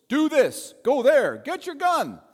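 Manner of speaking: officer ordering soldiers around; commanding officer. Retold or imitated. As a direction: snap out commands like a drill officer. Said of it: "what commanding officers tell soldiers".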